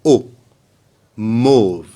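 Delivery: "Mauve" with AU as a closed o; AU pronounced O. Shown as a demonstration. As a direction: say "O", then "Mauve" with that same long o. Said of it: "Faites attention pour la prononciation".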